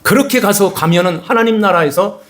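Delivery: full-force sermon climax; fervent preaching. Acted. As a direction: preach it out, building with fervor.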